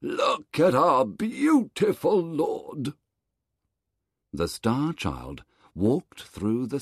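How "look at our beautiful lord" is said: high, slow awed voice; bowing guards; admiring guards. Acted. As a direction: praise the boy.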